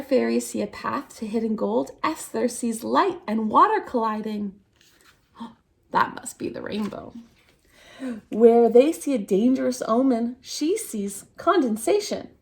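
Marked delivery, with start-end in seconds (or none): 5.4-7.1 gasp, then a soft whispered discovery; awed gasping whisper. Acted. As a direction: gasp in wonder, then hush. Esther discovers the rainbow.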